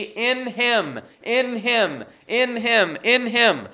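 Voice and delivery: "in" punched high, "him" falling away; stress on "in". Shown as a demonstration. Preacher shows congregation the repeated phrase, stressing "in". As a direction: lean hard on "in" in each short repeat. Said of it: "you heard me emphasizing the word in".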